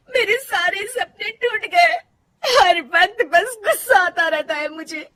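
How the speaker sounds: crying. Acted. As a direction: sob through the words about lost dreams. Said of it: "Rajni फूट फूट कर रोने लगती है।".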